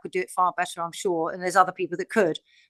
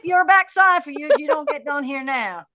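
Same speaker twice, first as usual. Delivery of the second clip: higher-pitched mock Southern scolding; Southern vernacular; 5 semitones higher than usual. Playful threat to whip a backside, in a Southern vernacular. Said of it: "in my Southern vernacular".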